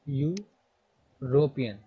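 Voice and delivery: European drawn out very slowly, syllable by syllable; teacher slowly sounding out European; slow spelled-out word. Shown as a demonstration. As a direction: stretch European out slowly as it is written in Hindi. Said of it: "वहाँ पे लिखा होगा".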